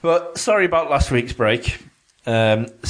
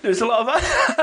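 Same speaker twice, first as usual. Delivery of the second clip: laughing; 10 semitones higher than usual. Retold or imitated. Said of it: "I don't know what that was".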